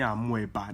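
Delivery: amoeba as "a-MWEH-ba", O sounded; imitated mispronunciation. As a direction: mimic the mistaken amoeba with an audible O. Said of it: "You guys have been saying".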